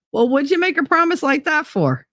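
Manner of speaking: high teasing reproach; mock scolding. Teasing a viewer about her chores, high mock scolding. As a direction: pretend to scold a friend. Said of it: "No, I'm kidding".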